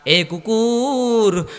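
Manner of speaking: high, long-drawn call; calling the dog. Acted. Master to the dog that would not bite, high drawn-out call. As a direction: call out slowly, letting the pitch fall.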